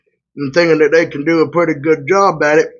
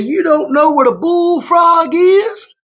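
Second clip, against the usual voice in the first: put-on Louisiana accent; 13 semitones higher, slower than usual. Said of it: "do this Louisiana accent".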